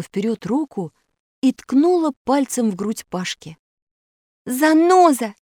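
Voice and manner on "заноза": high, loud accusing call; name the culprit out loud; loud declaration. A narrator's reading. Alisa unmasks the false Pashka, loud declaration. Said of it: "заявила она громким голосом".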